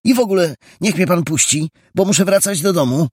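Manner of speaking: put-on high, fast, indignant boyish protest; a boy angrily demanding the stranger let him go, high and fast. A narrator's reading. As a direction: snap back at him, hurry to be let go. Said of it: "rzekł ze złością".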